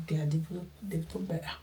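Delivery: dropped to a reluctant near-whisper; grudging whisper. Real, not acted. Admitting to viewers under his breath, grudging whisper. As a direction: concede it under your breath. Grudging aside about developed countries.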